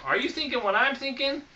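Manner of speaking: usual speaking voice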